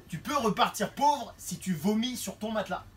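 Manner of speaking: ordinary talk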